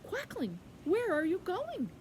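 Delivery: high, swooping questioning voice; calling river. Acted. River calling to Quackling, high inquiring voice. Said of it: "the river said".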